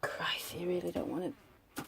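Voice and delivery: disgusted whisper. Real, not acted. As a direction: breathe it out, repelled. Recoils from the rotten smell.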